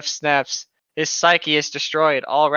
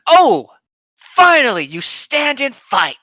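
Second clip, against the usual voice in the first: anime battle cry; 8 semitones higher, 7 dB louder, slower than usual. Acted. Dramatic anime battle line.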